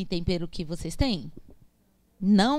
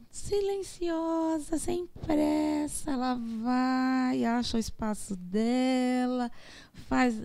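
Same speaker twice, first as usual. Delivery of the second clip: very slow, higher, unhurried flowing voice; slow like water; 3 semitones higher, much slower than usual. Shown as a demonstration. Teacher showing the class how water moves, slow and flowing. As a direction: drift through it like calm water.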